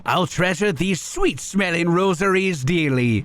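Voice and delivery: oversell the gratitude, mock French; greedy merchant thanking her in a cheesy French accent; bad French accent. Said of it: "My French accent is so bad".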